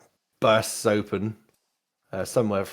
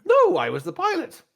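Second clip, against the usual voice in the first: high, loud droid correction; Nim; 5 dB louder than usual. Acted. Droid corrects master about being pilot, high voice. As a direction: protest as a high-pitched droid. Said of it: "I'll call you Nim".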